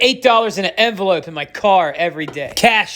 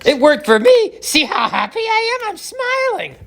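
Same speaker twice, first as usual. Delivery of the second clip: squeaky high mock-cheerful voice; sarcastic forced cheer, pitched way up; fake happy; 14 semitones higher than usual. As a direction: pretend to be blissfully happy, high and strained. Sarcastic fake-happy married man.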